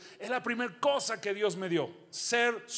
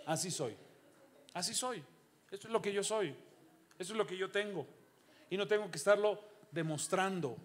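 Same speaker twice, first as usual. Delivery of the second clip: normal talking voice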